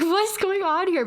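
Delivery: clueless sister reacting; bewildered. Sister retelling her confusion at the angry mom, high and slow. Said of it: "you're like".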